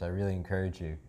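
ordinary talk, nothing marked

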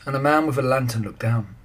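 ordinary delivery

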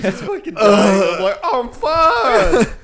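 loud, high, slow mock wailing; strained cry of fake agony; mock dying. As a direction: ham up dying in the heat. Mock suffering in the heat.

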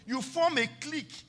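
usual speaking voice

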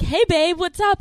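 high, loud imitation of a happy greeting; jolly. Man FaceTimes her from his couch, mock jolly. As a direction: chirp it like a carefree boyfriend.

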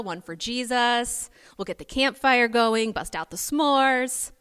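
imitating an overjoyed Peter, high and loud; hammed-up eager thrill, pitch leaping upward; giddy excitement. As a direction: gush like a jazzed, eager disciple. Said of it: "so excited, so hopeful, so jazzed".

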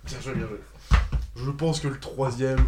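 ordinary talk

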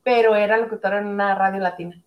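normal talking voice

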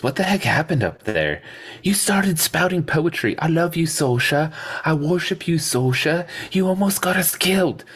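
high-pitched, incredulous scolding; hammed-up exasperated telling-off; mock exasperation. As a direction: recount it in a high, mocking pitch. Acted scolding of lovesick companion.

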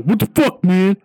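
mock outraged what the fuck; a hammed-up angry friend; retold angry outburst. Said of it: "He got all mad".

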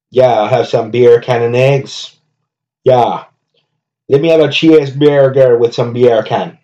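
a customer ordering food in mock Jamaican accent. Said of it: "[name] doing accents".

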